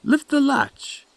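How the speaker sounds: very high granny voice calling; grandmother calling out. Acted. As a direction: call from bed in a high granny voice. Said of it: "called out the grandmother".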